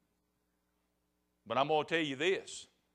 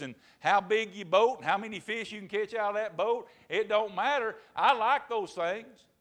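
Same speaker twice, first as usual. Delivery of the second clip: raised, insistent sermon delivery; high-energy country preacher exhortation; forceful preaching; 7 semitones higher, 4 dB louder than usual. Real, not acted. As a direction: preach it hard to the congregation.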